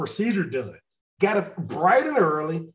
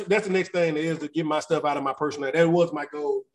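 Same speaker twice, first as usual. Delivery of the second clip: ordinary talk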